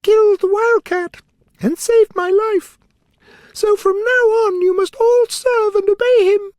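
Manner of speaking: tiny queen giving royal orders; squeaky but commanding; mouse queen command. A narrator's reading.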